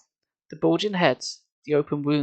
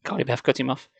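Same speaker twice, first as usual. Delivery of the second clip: usual speaking voice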